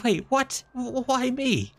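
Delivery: very high, squeaky alarm; panicked whine. Acted. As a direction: protest in sudden panic. Realizes he is the bait.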